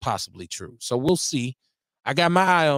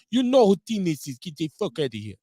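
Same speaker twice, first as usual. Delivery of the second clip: put-on Giannis accent, higher at the start; horrible Giannis accent; 4 semitones higher than usual. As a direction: do a bad, joking Giannis accent. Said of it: "That's a horrible accent".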